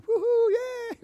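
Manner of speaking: very high whooping cheer. Shown as a demonstration. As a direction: whoop like a crowd celebrating. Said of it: "this is where everyone should be going".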